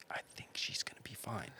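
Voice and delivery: soft reassuring whisper. Retold or imitated. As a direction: hush it to calm a scared kid. Calming a panicking kid.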